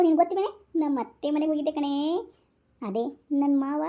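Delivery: normal talking voice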